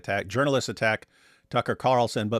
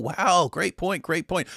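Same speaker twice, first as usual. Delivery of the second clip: mock admiration; 4 semitones higher, slower than usual. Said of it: "But you'll have people".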